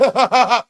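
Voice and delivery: big high laugh bursting out; loud burst of laughter. Real, not acted. Laughing out loud at the roast. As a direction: crack up loudly.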